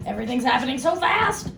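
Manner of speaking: high, rising exclamation; overwhelmed outcry. Real, not acted. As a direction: cry out as if it's all too much. Overwhelmed mock outcry at events.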